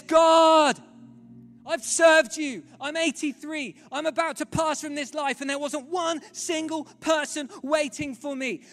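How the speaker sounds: loud, raw plea that fades down; anguished cry to God. Retold or imitated. Old missionary on his knees to God, loud anguished plea. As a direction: pour out a lifetime of hurt.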